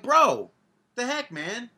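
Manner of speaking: high, loud indignant bro; outraged at the guy cutting in; annoyed. Retold or imitated. Bowler to the guy who rushed him, indignant and high. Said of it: "I'm like".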